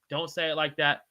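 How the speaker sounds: usual speaking voice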